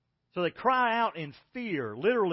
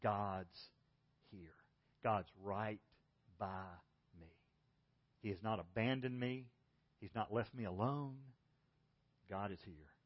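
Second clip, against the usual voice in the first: quiet affirmation; 8 semitones lower, 9 dB quieter than usual. Shown as a demonstration. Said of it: "just to say".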